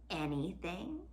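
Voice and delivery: soft, sly, drawn-out question; sly villain. Acted. Villain toying with the girl, soft sly question. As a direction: purr the word like a schemer.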